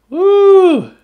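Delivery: high, loud whoop; excited whoop. Real, not acted. Breaker thrilled at an awesome card, loud and high. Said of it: "Sorry, I'm excited".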